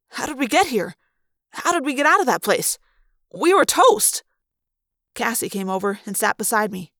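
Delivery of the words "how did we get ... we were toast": high, loud, incredulous; astonished outburst, building higher and louder; amazed, urgent questioning. Acted. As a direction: fire out baffled questions with rising excitement. Amazed at escape, demands answers.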